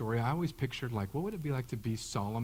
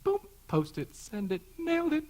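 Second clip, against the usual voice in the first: mock triumph; 4 dB louder than usual. Mock boasting about posting online.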